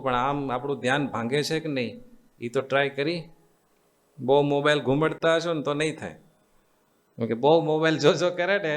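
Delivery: ordinary delivery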